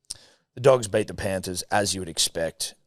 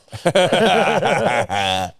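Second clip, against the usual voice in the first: high laugh; real laugh; 7 semitones higher, 6 dB louder than usual. Real, not acted. Laughs at story.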